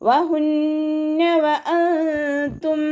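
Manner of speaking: വഹുന്ന വഅൻതും chanted slowly, lips rounded on വ; tajweed recitation. Shown as a demonstration. Teacher models rounded waw for students, slow chant. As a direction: recite വഅൻതും high and drawn, nasal ന held. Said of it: "ചുണ്ടുകളെ വട്ടത്തിൽ പിടിച്ചു".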